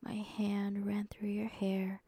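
plain reading voice

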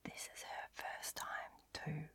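breathy whisper right in the ear. Acted. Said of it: "whisper in my ear".

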